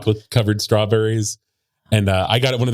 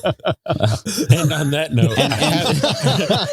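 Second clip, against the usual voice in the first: slow, laughing through the words; 6 semitones higher, much slower than usual. Real, not acted. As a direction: talk while cracking up. Laughing after the seductive bit.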